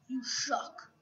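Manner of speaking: unvoiced whispered insult. Shown as a demonstration. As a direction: whisper it under your breath. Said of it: "repeated in whisper".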